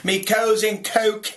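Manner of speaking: mock Cockney outburst after smashed fingers; Cockney swearing. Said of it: "he went into some Cockney Tourette's thing".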